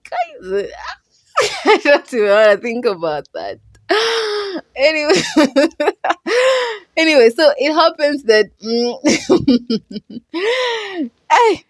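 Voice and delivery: half laughing, half crying, slowed at first; can't get the words out for laughing; laughing through it. Real, not acted. Vlogger cracking up recounting the twist, laughing helplessly.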